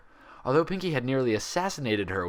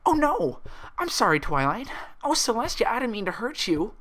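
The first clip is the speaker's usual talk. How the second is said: much higher, frantic sorry; panicked apology; 14 semitones higher than usual. A narrator's reading. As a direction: plead like a child caught out. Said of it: "her voice quivering".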